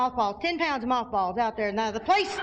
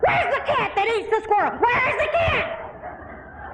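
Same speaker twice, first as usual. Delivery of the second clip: yelling for the cat; 8 semitones higher than usual. Retold or imitated. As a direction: bellow for the missing cat, half laughing. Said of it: "And I'm going".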